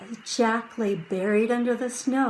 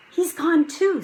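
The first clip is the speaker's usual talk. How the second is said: put-on young boy voice; 9 semitones higher, 5 dB louder than usual. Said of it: "said Jack".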